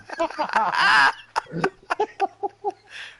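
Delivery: loud, high burst of laughter; big laugh. Real, not acted. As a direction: crack up at the joke. Laughing at own joke.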